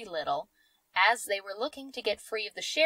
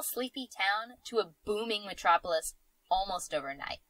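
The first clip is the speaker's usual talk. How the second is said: usual speaking voice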